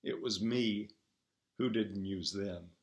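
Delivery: soft, wistful reply; a quiet regretful hush, lightly voiced. A narrator's reading. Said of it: "said Lottie wistfully".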